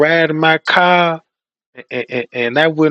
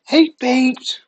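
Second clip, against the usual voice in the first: high, bright-voiced psychic hostess greeting; radio psychic hostess greeting her listeners, high and sweet; chirpy hostess; 9 semitones higher than usual. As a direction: sing out a hello to the callers.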